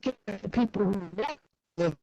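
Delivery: usual speaking voice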